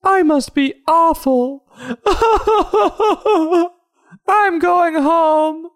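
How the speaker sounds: very high, drawn-out cartoon wail; hammed-up high-pitched meltdown; mock wailing. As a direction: wail it in a squeaky put-on voice. Acted upset character going home.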